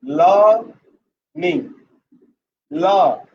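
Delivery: LEARNING split in two, long LAA, pause, NING; syllable by syllable. Shown as a demonstration. Teacher dividing the word into two syllables, syllable by syllable. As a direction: draw out the first syllable of LEARNING, then repeat it alone. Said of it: "divided the word into two syllable".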